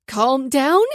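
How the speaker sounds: outraged disbelief at being told to calm down; incredulous. A narrator's reading. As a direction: throw his words back at him in disbelief. Incredulous echo of his words.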